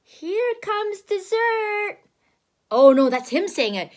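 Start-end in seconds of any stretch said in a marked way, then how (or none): 0.0-2.0 slow, higher sly wolf. Acted. Wolf anticipating dessert, slow and sly. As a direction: savour the next meal. Wolf's line, slowed.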